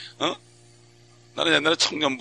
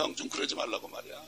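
very soft, whispered clinging plea; 4 semitones lower, 12 dB quieter than usual. Retold or imitated. Preacher whispering the young man's plea while clinging on. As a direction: hush it while holding on.

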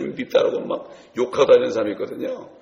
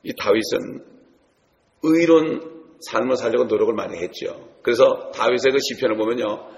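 ordinary talk, nothing marked

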